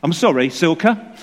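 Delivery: ordinary talk, nothing marked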